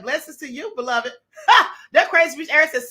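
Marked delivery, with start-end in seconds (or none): 1.5-1.7 loud high bark of laughter; sharp laugh. Real, not acted. Amused burst at a chat comment.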